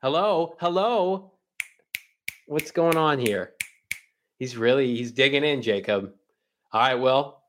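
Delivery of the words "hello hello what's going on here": high, sing-song "anyone there?"; calling out. Pretend. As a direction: call across the empty room. Calling out to a silent cohost.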